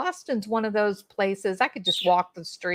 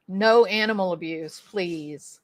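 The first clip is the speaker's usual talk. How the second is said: ordinary delivery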